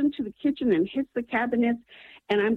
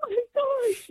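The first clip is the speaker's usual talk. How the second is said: much higher, slow, near-crying cry of disbelief; overwhelmed oh my gosh; slower than usual. Retold or imitated. Retelling the doctor's news, a choked, high cry of amazement. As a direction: let the shock and gratitude break through the voice. Hearing the scapular saved her.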